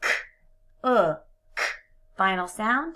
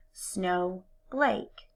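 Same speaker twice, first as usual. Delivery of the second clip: ordinary talk, nothing marked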